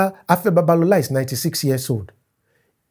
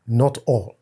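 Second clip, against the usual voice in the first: ordinary delivery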